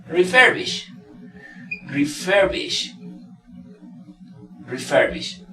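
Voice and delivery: slow, careful "refurbish"; demonstrating pronunciation. Shown as a demonstration. Teacher models a suffix word for learners, careful demonstration. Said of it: "la pronunciación del sufijo".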